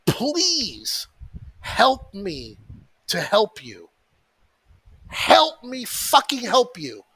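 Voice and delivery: a hammed-up pleading movie line; Jerry Maguire plea. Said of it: "on my hands and fucking knees like Jerry Maguire".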